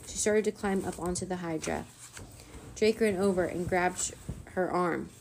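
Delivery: ordinary delivery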